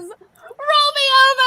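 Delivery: high, loud, slow announcement; showman announcement. Real, not acted. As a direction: announce the number like a host. Announcing the song.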